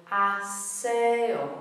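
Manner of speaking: "aseo" drawn out, beat on SE; a-SE-o, leaning hard on the middle syllable; slow three-syllable "aseo" with stressed e; penultimate stress. Shown as a demonstration. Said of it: "Beat is on the last but one syllable".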